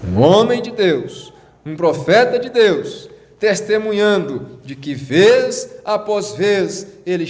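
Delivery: high-pitched, solemn proclamation; emphatic preaching. Acted. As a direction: proclaim each phrase as testimony. Preaches on the prophet's testimony.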